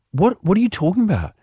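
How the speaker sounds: mock-bewildered comeback; retold bafflement. Retold baffled reply to accusation.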